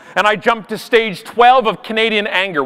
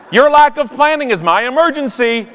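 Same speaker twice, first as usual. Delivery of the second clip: raised, sarcastic agreeableness; passive-aggressive politeness; 5 semitones higher than usual. Acted. To a late passenger jumping the line, sarcastic politeness. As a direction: pretend to be delighted for them. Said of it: "passive-aggressive politeness".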